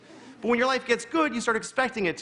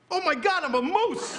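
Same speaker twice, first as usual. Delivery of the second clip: startled moose; 8 semitones higher than usual. Acted. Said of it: "he looked like he was going".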